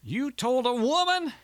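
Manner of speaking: very high, slow, incredulous bellow; outraged bellow. A narrator's reading. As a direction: stretch it out in high-pitched disbelief. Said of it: "bellowed Walter".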